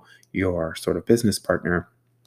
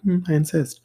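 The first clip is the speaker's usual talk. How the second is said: mock insisting; 10 semitones higher than usual. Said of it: "I'm like".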